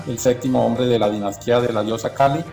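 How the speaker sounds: ordinary talk, nothing marked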